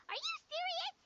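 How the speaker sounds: high, loud incredulous question; shocked disbelief. Acted. Companion reacts to the cursed blade theory, high shocked disbelief. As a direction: jump up in pitch, stunned by the news.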